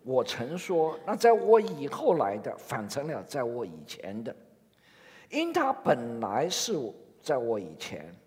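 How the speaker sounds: slow, measured Bible reading; scripture reading. Acted. Pastor reading John to the congregation, slow and solemn. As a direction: read the verses slowly and solemnly.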